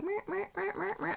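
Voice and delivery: high, soft, repeated little squeaks; imitated swatching noise. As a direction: mimic quick swipes with high little noises. Mimics frantic swatching in the shop.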